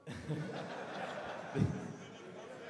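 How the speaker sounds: soft, airy, voiceless chuckle; a quiet laugh at his own answer; soft unvoiced laugh; breathy laugh. Real, not acted. Fighter laughs after answer.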